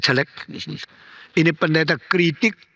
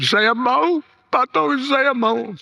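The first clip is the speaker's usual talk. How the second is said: higher, slow, earnest yes; yielding husband; 8 semitones higher, slower than usual. Retold or imitated. Dying husband agreeing to the pastor, high and slow.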